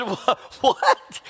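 a stunned "what" broken by real laughter; laughing disbelief. Real, not acted. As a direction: ask it again while still laughing. Retells shock, laughing.

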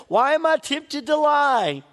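ordinary talk

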